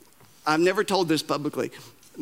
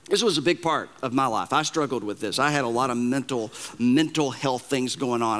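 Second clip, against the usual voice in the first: normal talking voice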